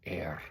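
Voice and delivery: soft, low "R" said air with a trill; rolled Latin R. Shown as a demonstration. Teacher leaning close so pupils hear, soft rolled R. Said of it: "get really close so you can hear it".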